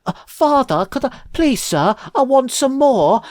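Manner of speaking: high, mock-posh English orphan begging; hammed-up posh English plea; posh English accent. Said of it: "I've suddenly become posh, a posh Englishman".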